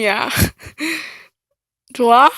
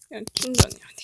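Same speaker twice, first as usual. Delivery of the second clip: whispering; 8 dB quieter, faster than usual. Real, not acted. Unvoiced whisper.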